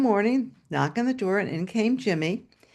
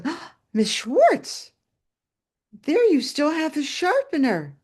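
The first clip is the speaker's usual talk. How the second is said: gasping, higher-pitched excited recognition; delighted surprise; 6 semitones higher than usual. Retold or imitated. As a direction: imitate a visitor's thrilled discovery. Visitor spots the old sharpener.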